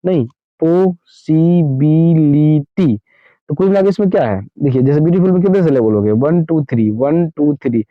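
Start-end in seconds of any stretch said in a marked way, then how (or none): 0.6-3.0 "possibility" very slowly, five separate beats, पॉ, सि, बि, लि, टी; split into syllables. Shown as a demonstration. Teacher giving a longer example, "possibility" slowly split syllable by syllable. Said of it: "हम लोग कैसे बोलते हैं?".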